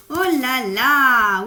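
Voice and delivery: loud, drawn-out amazement; big loud gasp of words; astonished exclamation. Real, not acted. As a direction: exclaim in surprise, stretching it out. Reacts to a card just drawn.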